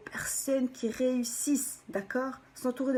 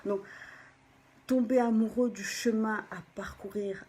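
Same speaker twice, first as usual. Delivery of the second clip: normal talking voice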